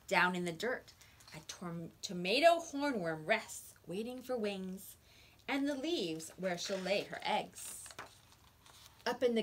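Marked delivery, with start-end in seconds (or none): none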